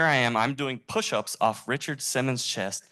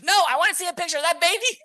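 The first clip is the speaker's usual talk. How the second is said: fast, insistent high shriek; hammy, very loud high-pitched demand; Richard Simmons; 8 dB louder, faster than usual. Retold or imitated. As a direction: insist at full volume, way up high. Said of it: "I kinda do a decent impression".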